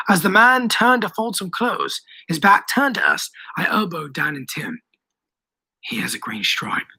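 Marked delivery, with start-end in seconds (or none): 5.8-6.9 low, hushed strained voice. A narrator's reading. Boy warns his friends of the man's stripe, strained. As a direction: strain it out under your breath. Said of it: "I strained".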